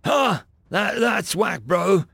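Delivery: high, incredulous reaction; pitched well up, taken aback; startled disbelief. A narrator's reading. New Marine hearing about cadence, incredulous.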